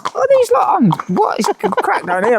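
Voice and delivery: high-pitched incredulous inner voice; baffled disbelief. Retold or imitated. Lost jockey wondering what the locals are on, high baffled squeak. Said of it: "I'm thinking".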